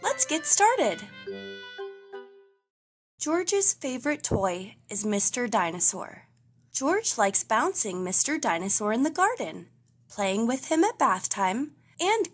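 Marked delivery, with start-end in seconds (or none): none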